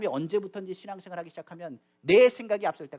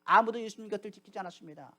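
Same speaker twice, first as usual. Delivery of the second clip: ordinary delivery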